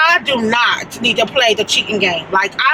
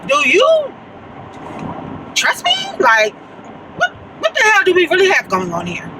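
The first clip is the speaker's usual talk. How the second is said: incredulous; 6 semitones higher than usual. Retold or imitated. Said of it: "looking at Sean sideways like".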